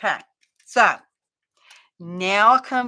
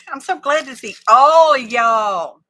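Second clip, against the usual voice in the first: effusive greeting; 4 semitones higher, 10 dB louder than usual. Real, not acted. Crafter greeting viewers in the chat, loud effusive greeting. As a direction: call it out to the whole crowd.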